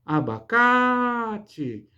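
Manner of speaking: "Abacate" chamado bem devagar, alongando e elevando o "CA"; chamamento. Shown as a demonstration. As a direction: chamar "Abacate" com o "ca" esticado e agudo. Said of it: "Vamos fazer um chamamento?". ("Abacate" como chamamento, sílaba "ca" tônica muito prolongada)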